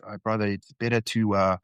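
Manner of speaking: put-on quoting a Kiwi uncle, barely putting on his accent; a faint Kiwi twang, not really committing to it; half-hearted Kiwi accent. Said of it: "I'm not even gonna try and do the Kiwi accent".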